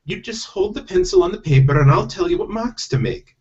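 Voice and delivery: high-pitched bossy Scottish wife; firm, high stage-Scottish voice; Scottish accent. Acted. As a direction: give instructions in a put-on Scottish accent. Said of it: "I do have to do Scottish accents".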